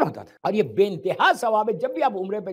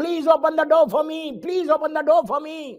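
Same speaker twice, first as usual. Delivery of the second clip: drawn-out pleading; 5 semitones higher, 3 dB louder, much slower than usual. Retold or imitated. Mocking believer's plea to God.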